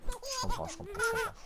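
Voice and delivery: high-pitched laughing repeats. Real, not acted. Laughing at the baby who snuck downstairs. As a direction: giggle the words out, amused.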